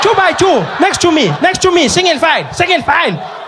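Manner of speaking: high, rising frantic calls; a teacher losing control of the kids, calling out; panicking teacher. Retold or imitated. Teacher panicking as kids run wild, high frantic shouting. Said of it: "She was like".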